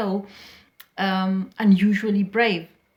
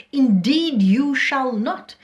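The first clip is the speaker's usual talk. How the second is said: louder, higher, firm command; 4 semitones higher, 5 dB louder, slower than usual. Acted. Mother forbidding her daughter-in-law to retire, commanding. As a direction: shut the request down with full authority. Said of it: "her tone is very commanding".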